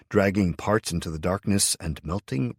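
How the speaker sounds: plain reading voice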